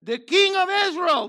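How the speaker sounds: loud, high acclaim of the crowd; crowd's proclamation. Retold or imitated. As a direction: call it out like the crowd hailing a king. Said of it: "they said".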